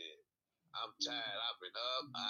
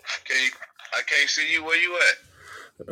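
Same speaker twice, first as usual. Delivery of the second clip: loud, raised voice over a failing call; shouting into phone; 3 semitones higher, 17 dB louder, slower than usual. Real, not acted. Bad video call connection.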